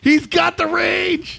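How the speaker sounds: high, loud, drawn-out caster's shout; excited shout. Real, not acted. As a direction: belt it out as a big game call. Caster calls out a hit.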